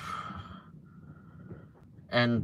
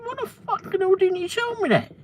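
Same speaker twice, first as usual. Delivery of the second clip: high, sharp cry of outrage, much higher than usual; retold angry outburst; 3 dB louder than usual. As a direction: throw the question at the sales manager in disbelief. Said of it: "I said".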